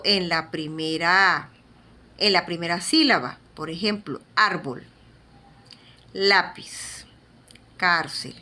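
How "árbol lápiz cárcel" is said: árbol, lápiz, cárcel, each weighted on its first syllable; first-syllable stress. Shown as a demonstration. Teacher lists examples for students, stressing the first syllable of árbol, lápiz, cárcel. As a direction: separate example words with pauses, leaning on "ár", "lá", "cár". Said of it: "la forma como se pronuncian".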